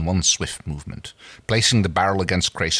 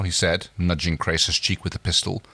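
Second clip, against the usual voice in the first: ordinary delivery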